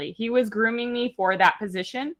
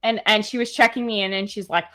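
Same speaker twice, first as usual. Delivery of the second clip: usual speaking voice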